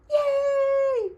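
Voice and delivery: high, gleeful little shout; kid's cheer. Acted. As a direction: cheer like a kid set free. Kids cheer school closing.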